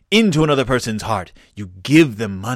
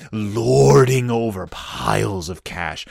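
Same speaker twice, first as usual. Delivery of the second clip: normal talking voice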